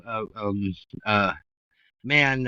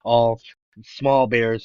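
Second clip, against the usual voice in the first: usual speaking voice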